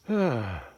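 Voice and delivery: falling end-of-day sigh; winding-down sigh. Real, not acted. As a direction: let out a voiced sigh, falling in pitch.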